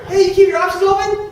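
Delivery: high, loud mock kid pitch to the waiter; imitating his son. Son pitching the waiter at dinner, high mock kid voice. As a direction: ham up the eager son. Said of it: "he's always talking to the waiter".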